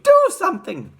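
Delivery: loud, high-pitched scream; piercing wifely demand; Missus Gloop. A narrator's reading. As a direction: shriek a frantic order. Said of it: "screamed at Mister Gloop".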